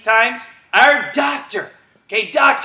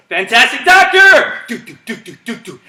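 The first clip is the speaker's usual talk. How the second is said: call then heartbeat; 2 semitones higher, 6 dB louder than usual. Real, not acted. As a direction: shout then tap a heartbeat. Calling command then heartbeat.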